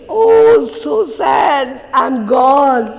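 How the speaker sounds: hammed-up high, loud lament; mock wail. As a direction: wail it like a melodramatic death scene. Mock mourning her own death.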